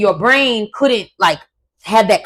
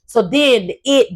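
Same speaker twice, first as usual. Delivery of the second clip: ordinary talk, nothing marked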